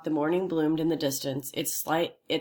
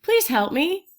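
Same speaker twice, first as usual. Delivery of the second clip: high, loud pleading; plaintive; 13 semitones higher, 4 dB louder, slower than usual. A narrator's reading. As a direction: beg pitifully and high. The voice pleads again.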